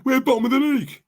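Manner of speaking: high, amused echo of him; mocking mimicry. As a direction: parrot his line in a high put-on voice. Mocking repeat of played clip.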